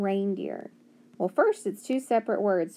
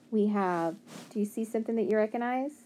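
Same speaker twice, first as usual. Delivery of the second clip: usual speaking voice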